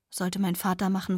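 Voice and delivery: ordinary delivery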